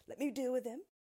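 a hint of a protective tin man; Tin Woodman voice. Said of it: "he said".

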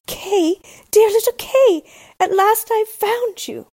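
high, loud joyful call. Acted. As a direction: call out to him with joy. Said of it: "and called out".

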